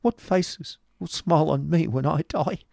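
high, fearful plea; frightened boy's despairing question; cried out, shivering. A narrator's reading. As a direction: cry it out high in dread. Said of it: "cried his companion, shivering".

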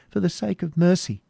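high, pleading cry; desperate plea. Acted. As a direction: beg for mercy. Captive pleading.

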